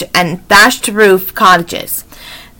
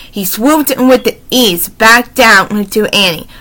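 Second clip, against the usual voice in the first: ordinary delivery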